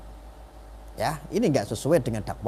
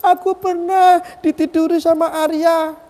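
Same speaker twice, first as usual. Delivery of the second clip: high, loud wailing imitation of crying; exaggerated sobbing squeal, drawn out slowly; mock crying; 14 semitones higher, 7 dB louder, slower than usual. Speaker mimics the woman tearfully accusing him, mock wailing. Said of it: "Dia nangis-nangis".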